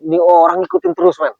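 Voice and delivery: exasperated cry at a stalker; exasperated high outburst. Real, not acted. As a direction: throw it up high, fed up. Exasperated at being followed.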